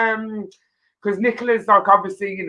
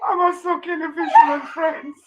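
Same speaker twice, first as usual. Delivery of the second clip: real laughter squeezing the voice high; helpless laughing; 7 semitones higher than usual. Real, not acted. Host pretends to put a caller through, cracking up. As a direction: get it out through a giggling fit.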